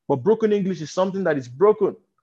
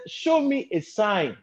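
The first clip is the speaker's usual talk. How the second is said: slow demand; 4 semitones higher, slower than usual. Acted. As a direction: draw the demand out gently. Quoted again, slow and soft.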